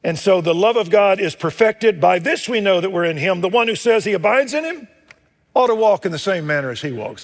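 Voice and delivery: loud, high pulpit voice reading scripture; booming sermon delivery; full-force preaching. Acted. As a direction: proclaim it to the whole congregation. Preaching opening scripture passage.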